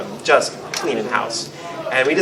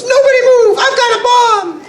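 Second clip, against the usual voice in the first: very high, loud goblin shriek; squeaky goblin; 7 dB louder than usual. Acted. Goblin bursting in with a bomb prop, shrieking high. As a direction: screech the threat like a squeaky goblin. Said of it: "talking super high and squeaky like goblins do".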